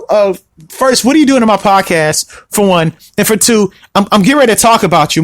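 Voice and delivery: ordinary talk, nothing marked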